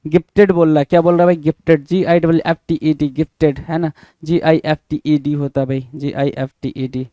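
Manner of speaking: normal talking voice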